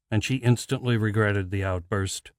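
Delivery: ordinary delivery, nothing marked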